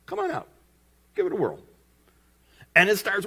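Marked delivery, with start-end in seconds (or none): 0.1-1.6 high, lightly tossed-off invitation; playful invitation. Retold or imitated. Jesus inviting Peter onto the water, playfully high. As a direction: coax him out in a raised voice.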